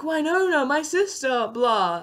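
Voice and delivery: high-pitched, hammed-up cry for a sister; lightly acted damsel wail, falling away; imitating a melodramatic call for help; mock plea. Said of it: "Than being like".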